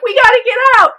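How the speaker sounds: high, loud, urgent yell to get out; panicked shout. Retold or imitated. Husband yelling to his wife on the tracks, panicked. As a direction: yell it as the train bears down. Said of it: "He's like".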